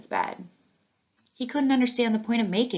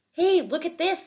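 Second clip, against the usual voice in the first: put-on high, louder child calling friends over; excited call; 11 semitones higher, 4 dB louder than usual. A narrator's reading. Aubrey calls her friends over to the flyer, excited. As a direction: point it out eagerly. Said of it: "said Aubrey".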